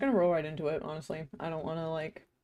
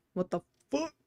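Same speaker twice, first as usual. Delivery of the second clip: surprised; 9 semitones higher than usual. Real, not acted. Startled by sudden hunger.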